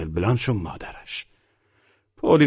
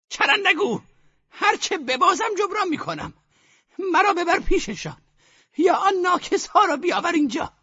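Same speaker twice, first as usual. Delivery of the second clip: put-on high, firm old woman's question; grandmother, firm and commanding; decisive. A narrator's reading. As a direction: ask as if already decided. Grandmother insists.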